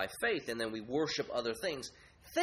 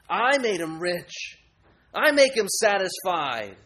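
higher, slow, louder, smug idol voice; boasting idol; 6 semitones higher, 8 dB louder, slower than usual. Acted. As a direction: brag like an idol. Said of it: "What would they boast".

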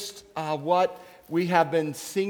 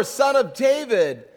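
louder, pleading cry; crying out more; 4 semitones higher, 6 dB louder than usual. Acted. Blind beggar crying out again despite the crowd, louder plea. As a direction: cry out all the more, begging. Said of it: "he cried out all the more".

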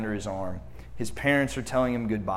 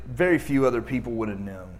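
usual speaking voice

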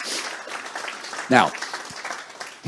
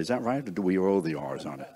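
normal talking voice

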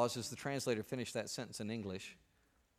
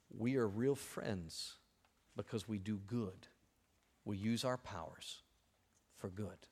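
slow, quiet and earnest; solemn close; 5 semitones lower, 10 dB quieter, slower than usual. Real, not acted. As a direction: press it home softly. Sermon closing vision.

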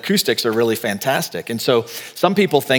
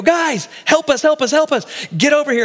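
much higher, urgent cries for help across the water; urgent calling out; 13 semitones higher than usual. Retold or imitated. Fishermen shouting to partners in the other boat, urgent high calls. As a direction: call the other boat in a panic.

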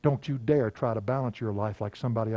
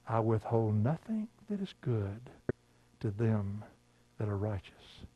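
softer, slower, solemn low voice; voice of God; 3 semitones lower, 6 dB quieter, slower than usual. Retold or imitated. Preacher quoting what God told him, hushed and slow. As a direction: let it sink in, quiet and weighty. God's voice heard in memory.

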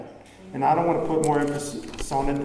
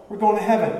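ordinary talk

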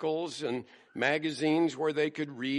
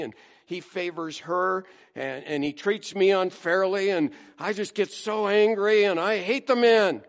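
higher, louder pleading whine; mock-whiny, begging Paul to understand; hammed-up grievance; whiny complainer; 5 semitones higher, 6 dB louder than usual.

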